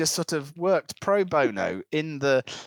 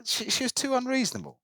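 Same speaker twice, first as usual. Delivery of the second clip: higher-pitched Scottish islander imitation; retold in a Scottish voice; Scottish accent; 11 semitones higher, faster than usual. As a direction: mimic the Scottish local, pitched up. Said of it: "make sure the accent goes for at least".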